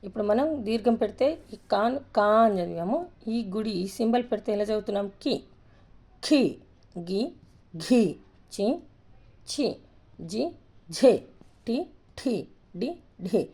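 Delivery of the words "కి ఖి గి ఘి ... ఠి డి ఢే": short "కి", then breathy "ఖి", in alternation; letter pronunciation drill. Shown as a demonstration. Teacher modelling syllables, plain "చి" against aspirated "ఝే". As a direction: puff out "ఘి" and "ఠి", keep "గి" and "టి" clean. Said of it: "ఈ విధంగా పలకాలి".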